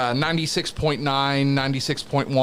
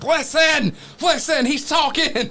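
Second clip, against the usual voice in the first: high, excited calling out; 14 semitones higher than usual. Retold or imitated. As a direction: call everyone over, thrilled. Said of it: "I pulled everybody to the curtain".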